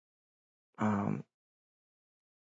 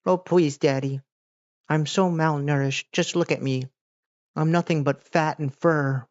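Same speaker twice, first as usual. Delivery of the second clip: higher-pitched pleading pet cat voice; put-on high voice of a whiny pet; begging cat; 7 semitones higher than usual. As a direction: voice a pitiful cat begging for scraps. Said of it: "I'm just a cat".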